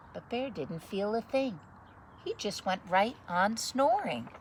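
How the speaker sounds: put-on lively read-aloud voice for children; story narration. Reading a picture book to children, lively story narration. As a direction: read it like bedtime story time.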